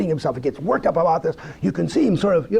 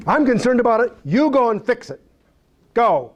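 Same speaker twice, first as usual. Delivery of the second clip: raised-pitch, insistent order; God passing the job on; 7 semitones higher than usual. Acted. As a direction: hand over the job as a mock divine command. Acts out God dispatching Moses.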